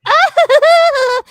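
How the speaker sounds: high, loud cackling hag laugh; imitated evil cackle. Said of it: "She laughs like such a cackling".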